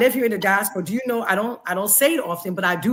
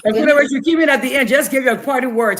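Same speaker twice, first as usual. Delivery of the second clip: louder, pressing to finish; host cutting guests off to stay on schedule, louder; hurried wrap-up; 6 dB louder than usual. Real, not acted. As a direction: push everyone along, loud and brisk.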